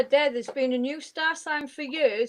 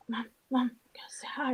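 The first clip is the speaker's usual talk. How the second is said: put-on whispered child asking; 9 dB quieter than usual. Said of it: "She was like".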